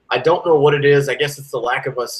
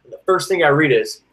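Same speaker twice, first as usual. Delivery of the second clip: ordinary delivery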